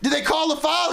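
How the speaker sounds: high, drawn-out holler to a player; calling out. Retold or imitated. Coach checks on his player down on the floor, hollering. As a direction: re-enact calling across the court.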